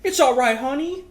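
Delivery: high, loud, cooing mock mother; mock comforting mom. Mother soothing her lying son, hammed-up high coo. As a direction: coo it over him like a doting mom.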